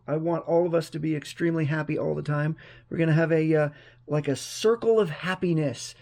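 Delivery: usual speaking voice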